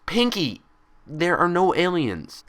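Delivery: hissed rebuke. A narrator's reading. Twilight hissing at Pinkie, losing patience, slow and pressed. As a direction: hold back exasperation through each word. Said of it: "Twilight hissed".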